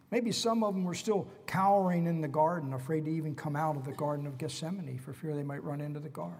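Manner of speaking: usual speaking voice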